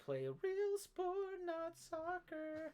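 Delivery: very high, slow crowing; mock patriotic boast. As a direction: stretch each word in hammed-up pride. Mock American boasting continues.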